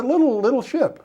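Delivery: playful high-pitched understatement; squeaky small voice. Acted. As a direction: squeak it out to make the ship sound tiny. Mocking how small the ship was.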